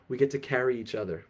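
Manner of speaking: ordinary talk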